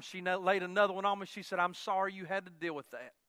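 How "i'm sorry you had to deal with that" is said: imitated wife, low and soft; tender apology. Wife consoles husband after a kiss, soft and low. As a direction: murmur the sympathy quietly, well down in pitch.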